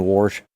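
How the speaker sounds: "wash" said as "warsh"; extra R. Shown as a demonstration. Owning up to extra R's, "wash" said "warsh". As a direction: put an R before the SH in "wash". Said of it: "you put extra R's in your words".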